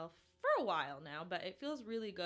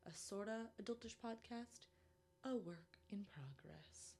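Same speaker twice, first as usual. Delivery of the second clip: whispered slogan; 7 dB quieter than usual. Acted. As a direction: breathe the tagline like a secret. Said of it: "slogan delivered".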